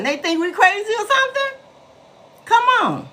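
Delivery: high, incredulous outrage; indignant disbelief. Real, not acted. Indignant about being seen as senile.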